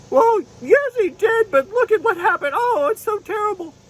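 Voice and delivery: very high, grudging unicorn; little unicorn admitting it unwillingly; sulky unicorn puppet. Pretend. Unicorn puppet conceding to the pastor, very high.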